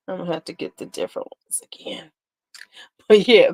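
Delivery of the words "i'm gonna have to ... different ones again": soft whispered aside to herself; breathy whispered mutter. Real, not acted. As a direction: drop to a whisper, talking to yourself.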